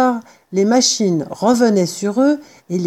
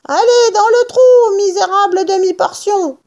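very high, loud command; bullying order; 12 semitones higher, 4 dB louder than usual. A narrator's reading. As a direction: order the little man down, shrieking high. Bean rudoie Buns. (ordre brutal, voix suraiguë)

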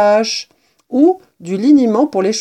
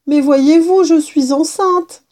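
high, louder imploring voice; implore, raised and drawn out at the end; pleading mouse; 9 semitones higher, 5 dB louder than usual. A narrator's reading. Pregnant mouse appealing to the kitten, high and pleading.

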